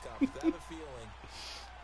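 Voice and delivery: quiet high laughter; soft laugh. Real, not acted. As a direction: laugh softly. Laughing at friend hating riddles.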